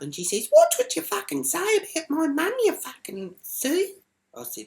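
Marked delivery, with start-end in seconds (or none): 0.5-3.9 high, raised mock warning; loud, squealed threat, mocking her; mimicked irate daughter. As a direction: imitate her telling you off, pitched way up. Retold doorstep row, mimicking the daughter.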